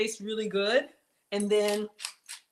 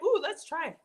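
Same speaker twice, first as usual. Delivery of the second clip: high, eager little ooh; lightly voiced eager self; imitating eager self; 7 semitones higher than usual. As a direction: mimic your own excitement at a recipe. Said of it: "and I'm like".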